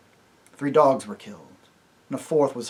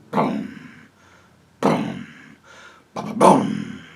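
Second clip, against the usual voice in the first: much lower, deep booming drum imitation; drum booms; 7 semitones lower, 5 dB louder, much slower than usual. Shown as a demonstration. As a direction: boom like a big war drum. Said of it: "it went".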